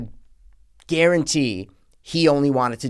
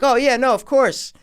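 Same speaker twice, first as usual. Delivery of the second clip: imitated dad; 10 semitones higher than usual. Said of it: "he's like".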